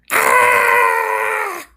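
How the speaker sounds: high startled scream; imitated scream. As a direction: scream like a dog hitting pavement. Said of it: "he just keeps screaming".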